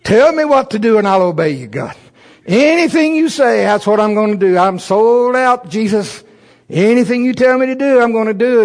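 high, loud, fervent pleading; hammed-up zealous prayer, pitched high; eager prayer. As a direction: promise God everything, all in. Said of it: "And I've been praying".

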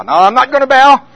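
high, loud refusal; defiant retort. Retold or imitated. Preacher refusing his body's taunt, high and loud. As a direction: snap back at the taunt.

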